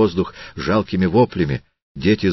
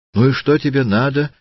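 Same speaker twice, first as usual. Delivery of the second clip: raised, high-pitched indignant retort; crying out; 8 semitones higher, 4 dB louder than usual. A narrator's reading. Widow confronts the hooligan at her gate, crying out. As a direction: cry it out high, short of a full shout. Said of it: "закричала еврейка".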